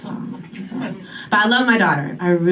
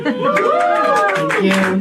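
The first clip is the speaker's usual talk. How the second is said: high, mock-grand thank you; milk the applause in a high voice; 13 semitones higher than usual. Comic taking the room's applause in a high voice.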